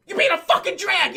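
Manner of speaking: high, loud angry outburst; retold yell, lashing out at a partner; yelling at her. Man swearing at her for the first time, angry yell. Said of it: "Just yelling at her".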